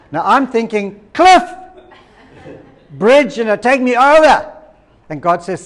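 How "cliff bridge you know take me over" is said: high, loud mock cry of despair; desperate shout. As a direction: shout it out as if at the end of your rope. Acting out desperate thought while driving.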